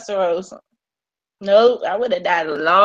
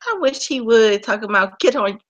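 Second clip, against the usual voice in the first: ordinary delivery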